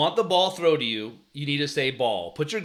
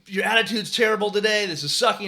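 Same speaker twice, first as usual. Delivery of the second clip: higher, louder dressing-down; frustrated yell; 6 semitones higher, 5 dB louder than usual. Retold or imitated. Coach calling out first baseman before the whole team, frustrated yell. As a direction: re-enact chewing out a player. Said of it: "I start yelling at him".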